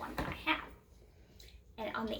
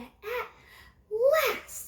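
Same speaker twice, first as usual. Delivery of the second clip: higher, laughing at last; relieved exclamation; 10 semitones higher, 6 dB louder than usual. A narrator's reading. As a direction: let out the relief. Man relieved.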